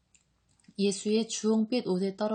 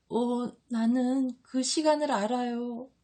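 higher, weeping disciple voice; tearful; 5 semitones higher than usual. A narrator's reading. John tells Jesus he knows the time, tearful. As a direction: say it through acted tears. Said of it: "하고 요한이 울면서 말한다".

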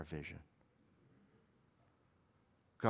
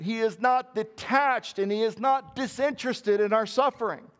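raised, higher preaching voice with a firm NOT; preaching at full force; 8 semitones higher than usual. Acted. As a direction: proclaim it like a preacher from the pulpit.

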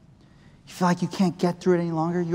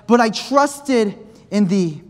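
slow reading; 3 semitones higher, 4 dB louder, much slower than usual. Shown as a demonstration. Verse read slowly, then preached.

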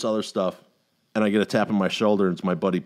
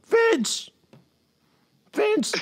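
calling out. Retold or imitated. Film crews calling the wrong name.

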